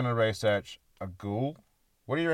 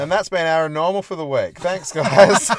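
higher, louder presenter sign-off; mock show sign-off; 5 semitones higher, 8 dB louder than usual. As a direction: wrap it up like a host.

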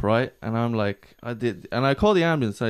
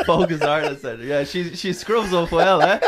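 laughing it out, higher and louder; 8 semitones higher, 6 dB louder than usual. Real, not acted. Joking with friends about the woman, real laughter, higher and louder. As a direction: crack up while joking. Joking she's here today.

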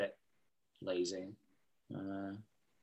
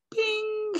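cartoon bing sound. Joe's eyes pop, sound effect.

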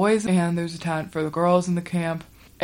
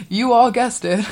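higher-pitched, amused, through a laugh; 4 semitones higher than usual. Real, not acted. Storyteller to listeners at the predictable twist, laughing. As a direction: laugh as you say it.

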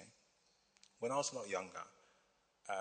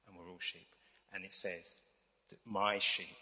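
normal talking voice

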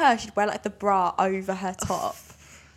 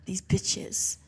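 scornful mutter; 2 semitones lower, 4 dB quieter than usual. Pretend. Imagined Parisian's scorn.

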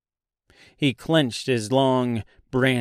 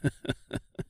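low-volume, staccato chuckle; mocking chuckle; 9 dB quieter than usual. Tin Woodman answering the tree, soft mocking chuckle. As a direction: chuckle softly in character.

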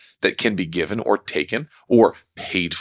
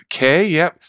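irritated brush-off; 8 semitones higher, 4 dB louder, slower than usual. Retold or imitated. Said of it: "And I get a little more irritated".

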